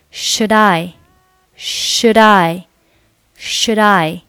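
"should I" joined as "shu-dai"; linked should I. Shown as a demonstration. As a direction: carry the d of "should" into "I". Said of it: "后面的should和I连读成".

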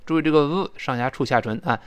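usual speaking voice

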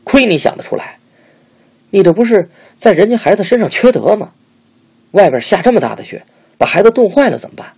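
high, loud angry yelling; furious roar. A narrator's reading. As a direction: tear into her in outrage. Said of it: "大声地吼道".

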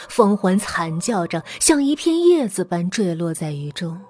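usual speaking voice

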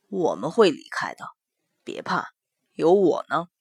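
low, soft comforting voice; reassuring. Acted. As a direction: soothe him quietly. Mo Jie soothes.

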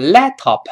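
laptop with an exaggerated, over-released p; wrong, extra syllable. Shown as a demonstration. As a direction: overdo the p in laptop to show the error. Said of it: "更不能读成laptop".

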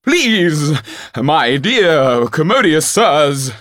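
very high, loud, slow theatrical plea; a hammy thespian begging grandly for mercy; captured actor pleading with the robbers, overblown; pompous actor pleading. Acted. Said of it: "cried the gentleman".

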